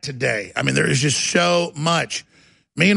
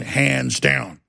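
ordinary talk

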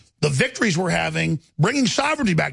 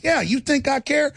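higher, scoffing retort, retold; defiant scoff; 8 semitones higher than usual. Host answering the scared hecklers with a retold higher scoff. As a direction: brush off their threats with scorn. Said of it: "I'm like".

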